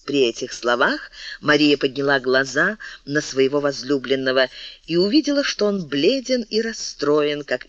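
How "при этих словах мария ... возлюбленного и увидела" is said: ordinary delivery, nothing marked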